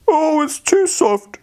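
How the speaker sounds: put-on high, slow little-girl voice; Goldilocks. Goldilocks voice in story.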